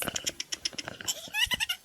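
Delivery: high yelping laugh; shrieking laugh. Real, not acted. Big laugh at his joke.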